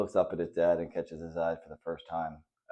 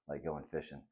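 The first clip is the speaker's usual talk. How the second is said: low, soft fatherly agreement; smiling confirmation; 3 semitones lower, 9 dB quieter than usual. A narrator's reading. Dad confirms his son's guess, low and gently smiling. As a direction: confirm it softly with a smile, "fishing" settling low. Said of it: "his dad says and smiles".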